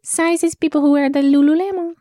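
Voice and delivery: high tiny-creature voice; squeaky little character voice. Pretend. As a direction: play a small eager salesman. Ramplu character continues.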